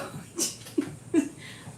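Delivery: airy laugh, way up high; a squeaky unvoiced giggle; high breathy laugh. Real, not acted. Owner giggling at her dog, high and airy.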